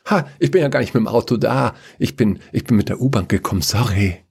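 very fast, stumbling explanation; flustered Chaos. Acted. Chaos back from the search for a car, flustered. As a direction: rush it out, then apologise.

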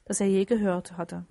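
ordinary delivery

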